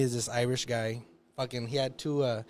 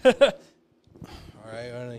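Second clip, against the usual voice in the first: tired off-air mumble; 4 semitones lower, 3 dB louder than usual. Retold or imitated. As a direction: drop the TV energy, flat and done. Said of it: "goes from this".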